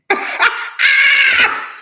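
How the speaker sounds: burst of shrieking laughter. Real, not acted. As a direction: let out a sudden high whooping laugh. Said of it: "I laughed".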